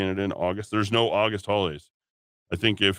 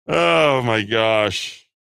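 mock exclamation; 6 dB louder, much slower than usual. Real, not acted. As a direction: exclaim it in amused disbelief. Host exclaims at own joke.

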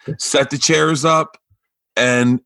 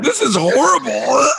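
higher mock crowd groan; mock horrified crowd; 12 semitones higher, slower than usual. Comic telling the host how the crowd groaned. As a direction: play the appalled audience.

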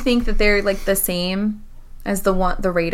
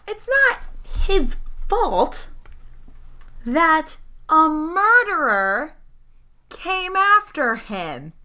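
higher pitch, phrases slow and separated; indignant protest; 5 semitones higher, much slower than usual. Acted. Player's character defends soldier to his boss, indignant. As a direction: defend him hotly, word group by word group.

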